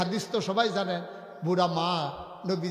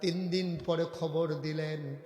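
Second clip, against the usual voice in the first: soft sermon storytelling; 2 semitones lower, 6 dB quieter than usual. Acted. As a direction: tell it gently in an even lilt. Preacher intones story in soft voice.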